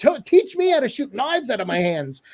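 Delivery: Kano's quip. Retold or imitated. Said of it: "he goes".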